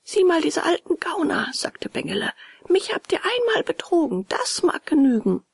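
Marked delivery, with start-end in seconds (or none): 0.0-1.5 put-on high, eager little boy's voice; a small boy offering help, pitched high; high boyish character voice; voiced puppet boy. A narrator's reading. Puppet boy scorns the beggars. 2.7-5.4 put-on high, indignant boy's voice; high-pitched character voice, firm; scornful puppet boy. A narrator's reading.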